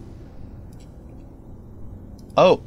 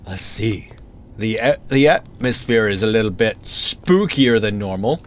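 ordinary delivery

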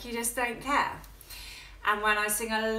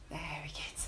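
whisper; 7 semitones lower, 15 dB quieter than usual. Real, not acted. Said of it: "whispered praise".

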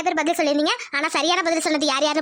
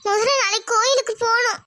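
higher, uneasy agreement; agree, still uneasy; troubled wife; 6 semitones higher than usual. Acted. Wife agreeing to temple visit, uneasy.

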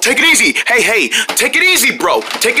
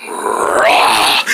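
deep breathy growling roar; low monster roar; 14 semitones lower than usual. Acted. Monster Cunny roars at his fleeing friends, deep growl. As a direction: roar like a hungry beast closing in.

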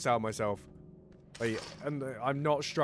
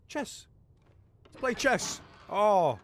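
excited; 8 semitones higher, 5 dB louder, slower than usual. Real, not acted. Excited discovery of chess.

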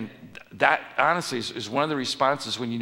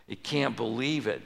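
ordinary talk, nothing marked

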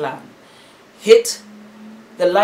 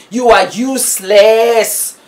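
You are useless, slow and louder; slow loud scorn; 3 semitones higher, 6 dB louder, slower than usual. Real, not acted. Vlogger scolding absent men, slow loud scorn. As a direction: spell it out with contempt.

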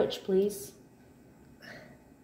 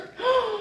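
high, delighted gasp; happy gasp; 12 semitones higher than usual. Shown as a demonstration. Mother showing her child happy, delighted gasp. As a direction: gasp as if thrilled. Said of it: "What about happy?".

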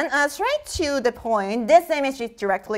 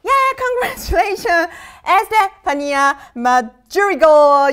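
excited congratulations; 4 semitones higher, 4 dB louder than usual. Real, not acted. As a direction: hail the winner like a show host. Announces a quiz winner.

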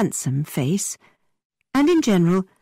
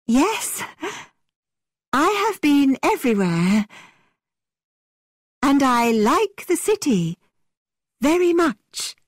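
theatrical actress; 9 semitones higher than usual. A narrator's reading. Catherine plays the theatrical game.

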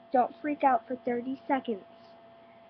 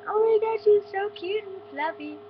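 high, loud re-enacted squeal of delight; retold gushing; 10 semitones higher, 8 dB louder than usual. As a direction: gush over an adorable pet to the parents. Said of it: "telling my parents all about her".

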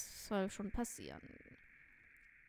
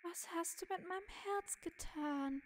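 high, subdued character voice; high-pitched acted lament; plaintive game figure; 7 semitones higher than usual. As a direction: ask it woundedly, pitched up high. Figure asks about its stolen heart.

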